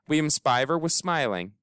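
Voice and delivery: ordinary delivery, nothing marked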